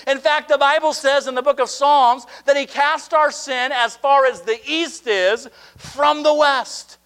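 high, loud revival preaching, drawn out on key words; preaching at full force. Acted. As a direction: preach it to the rafters, slowing on the big lines.

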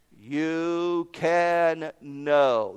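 very slow, gentle "You can know"; calm assurance. Real, not acted. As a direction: reassure them slowly. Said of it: "assurance to listeners".

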